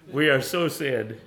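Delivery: ordinary delivery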